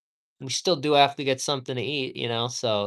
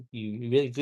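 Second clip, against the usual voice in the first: ordinary talk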